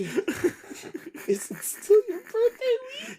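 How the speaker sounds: high, slow, laughing question; amused mock incredulity; laughing disbelief. Real, not acted. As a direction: stretch it out through a laugh. Mocking disbelief while laughing.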